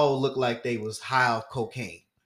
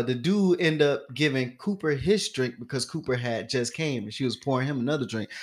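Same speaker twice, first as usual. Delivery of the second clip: ordinary talk, nothing marked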